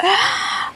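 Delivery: a sharp, high gasp of mock shock; quick shocked intake of breath; surprised gasp. Real, not acted. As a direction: gasp as if caught out. Asked about his feet.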